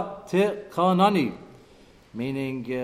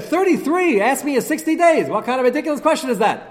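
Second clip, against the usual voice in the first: high, loud, indignant boss; retold yelling back; 9 semitones higher, 7 dB louder than usual. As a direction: yell back as if the question is absurd. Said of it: "He yelled back".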